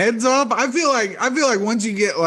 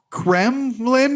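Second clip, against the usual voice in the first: Kremlin said higher and slower, each sound clear; clear repeat of Kremlin; 5 semitones higher than usual. Real, not acted. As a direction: spell out Kremlin, higher and deliberate. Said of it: "one more time?".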